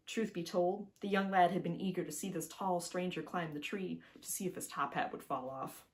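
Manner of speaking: plain reading voice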